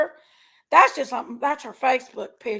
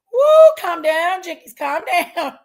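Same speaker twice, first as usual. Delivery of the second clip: high, loud, drawn-out woo; worked-up whoop; 4 semitones higher, 6 dB louder, slower than usual. Real, not acted. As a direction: whoop it out to blow off steam. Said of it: "I'm talking out of impulse now".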